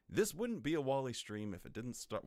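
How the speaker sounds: mock news anchor read; newscaster voice. Streamer opening his live stream slips into a mock newscaster voice. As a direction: announce it like the evening news. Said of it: "Why am I talking like a newscaster?".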